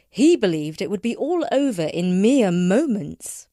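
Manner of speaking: usual speaking voice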